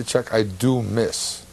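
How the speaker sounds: ordinary delivery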